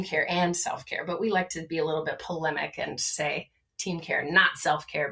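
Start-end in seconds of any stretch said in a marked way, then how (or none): none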